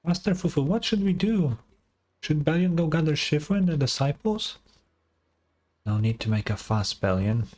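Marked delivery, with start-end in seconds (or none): 0.0-4.6 put-on higher, anxious kid voice reading his lines; worried young sidekick. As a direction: voice the nervous little helper, pitched up. Streamer voices worried young character.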